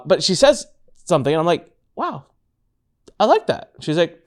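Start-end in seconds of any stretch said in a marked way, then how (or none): 1.9-3.7 high, bright wow of approval; mock impressed reaction. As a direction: sound pleasantly impressed. Reacting to wife's theme.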